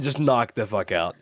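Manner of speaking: ordinary talk, nothing marked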